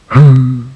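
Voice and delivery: long weary sigh; heavy sigh. Acted. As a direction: sigh like giving up. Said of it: "Sad Sack just sighed".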